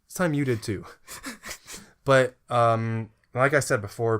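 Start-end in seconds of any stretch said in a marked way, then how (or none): 0.9-1.8 声を出さずに息だけで笑う; breathless laugh. Real, not acted. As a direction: silent wheezing laugh. Laugh after joke.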